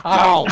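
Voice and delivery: loud, high comic yelp; pained yelp. Acted. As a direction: yelp like you've been bitten. Joke vampire bite scene.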